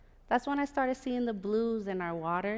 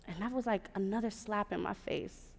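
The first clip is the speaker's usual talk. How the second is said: normal talking voice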